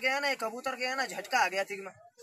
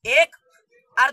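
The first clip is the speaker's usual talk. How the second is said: sudden shout; 9 dB louder, much slower than usual. Acted. Sudden loud count in trick.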